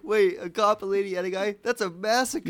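high-pitched incredulous retelling; mock disbelief. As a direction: react as if stunned, hamming it up. Acts out incredulous reply.

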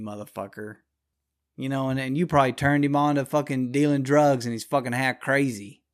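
ordinary talk, nothing marked

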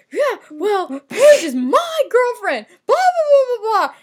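put-on high, loud sulky rant, slowing on the girlfriend part; loud, high jealous boyfriend going off; whiny tantrum. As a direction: rant it like a teen boy having a fit. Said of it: "he's like".

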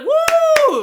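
high, loud whoop of a cheering crowd; excited cheer. Pretend. Host acting out the crowd celebrating, excited high whoop. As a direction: let out a squealing party woo. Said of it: "we'd be like".

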